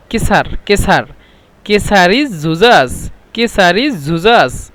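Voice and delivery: কেসারি জুজাজ said slowly, syllable by syllable; slow, deliberate কেসারি জুজাজ for repeating; careful Saudi pronunciation. Shown as a demonstration. Teacher modelling Saudi কেসারি জুজাজ slowly for students. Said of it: "এভাবে".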